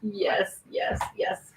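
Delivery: whispered yes, yes. Real, not acted. Host agreeing quietly off mic, whispered. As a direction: breathe it under your breath.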